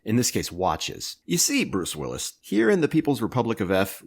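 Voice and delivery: ordinary talk, nothing marked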